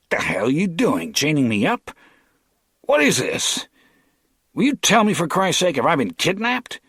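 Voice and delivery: high, loud and rising in alarm; panicked outraged protest. A narrator's reading. Chained captive yelling at his kidnappers, high and alarmed. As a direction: demand answers, voice climbing.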